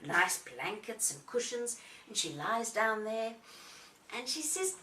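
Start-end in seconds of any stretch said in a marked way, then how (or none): none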